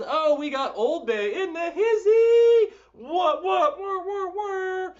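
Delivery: high, loud playful shout; excited. Real, not acted. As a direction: call out in delight. Excited greeting of joiner.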